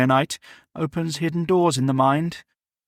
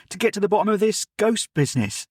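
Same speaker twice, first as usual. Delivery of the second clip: higher, quick and eager lad; eager boy Jim; 7 semitones higher, faster than usual. Acted. Servant boy guessing the professor's purpose, quick and eager. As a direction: blurt it out like a keen boy.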